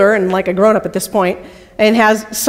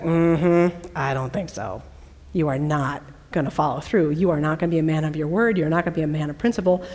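soft, low, quickening sass; a knowing put-down that speeds up; unimpressed; 6 semitones lower, 9 dB quieter than usual. Retold or imitated. Tamar telling Judah she doesn't believe him, low and softer.

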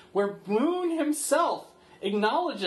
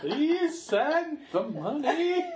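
higher laughing hostage plea; hostage mother voice; 8 semitones higher than usual. Pretend. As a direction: plead as a captive mother, laughing.

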